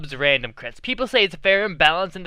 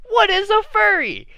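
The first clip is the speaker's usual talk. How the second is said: high-pitched quoted question; 14 semitones higher than usual. Retold or imitated. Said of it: "you are all probably wondering".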